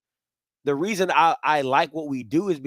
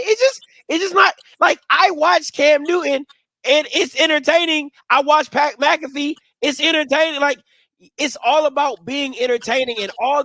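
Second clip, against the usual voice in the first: higher and louder, all fired up; excited rant; 8 semitones higher, 6 dB louder than usual. Real, not acted. Ranting to the cohost, fired up and louder. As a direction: get heated about being entertaining. Passionate rant about entertaining content.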